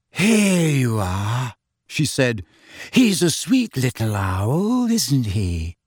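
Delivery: put-on slow, airy, far-off girl; dreamy Luna voice. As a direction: drift through it dreamily. Luna's dreamy voice.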